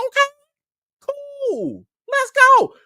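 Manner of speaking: high, loud hype; thrilled. Retold or imitated. Vlogger hyping himself up over the junket, high and loud. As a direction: fire up, ready to go.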